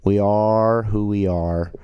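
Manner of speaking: slow, stretched-out repeat; drawn out. Real, not acted. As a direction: draw out each word for emphasis. Line repeated slowly for emphasis.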